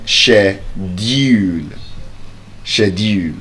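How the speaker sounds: "schedule" slow as "shedool"; British shedule. Shown as a demonstration. Teacher shows the sh- pronunciation. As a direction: give the sh- "schedule". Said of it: "if I say she".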